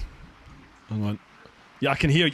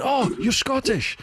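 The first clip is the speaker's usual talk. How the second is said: high, drawn-out cry of mock delight; delighted realisation; 11 semitones higher, slower than usual. Petrol station lady learning he's Scottish, imitated delight. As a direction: imitate her swooning with discovery.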